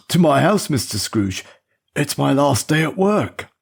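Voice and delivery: put-on an old chauffeur stammering an excuse to his boss; nervous old chauffeur.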